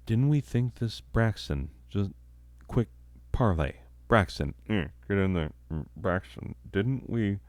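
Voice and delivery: quietly confer with a colleague mid-show; hushed aside. Acted.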